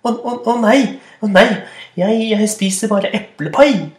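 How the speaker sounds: high stammering plea; frightened troll. Acted. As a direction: stutter out a scared excuse. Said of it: "sa den mellomstore trollet Brake". (redd stammende troll)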